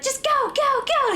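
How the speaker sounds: retold yelling. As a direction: yell at the babysitter to go, go. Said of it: "she started yelling at me".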